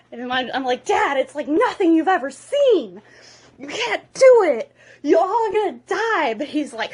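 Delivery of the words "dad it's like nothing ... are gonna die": high, loud theatrical shouting; desperate warning. Acted. Hiccup warns dad off the dragon hive, desperate shout. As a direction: plead at full voice, hammed up.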